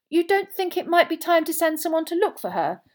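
high, hesitant suggestion; tentative. A narrator's reading. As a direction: float the idea cautiously, voice lifted. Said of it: "Mister Weasley suggested tentatively".

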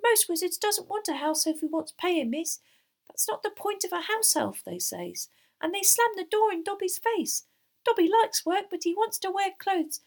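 high, squeaky, emphatic elf; proud little servant, pitched very high; earnest house elf. A narrator's reading. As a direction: pour out the grievance in an elf squeak. Narrator voices Dobby the house elf.

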